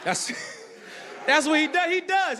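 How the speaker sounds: normal talking voice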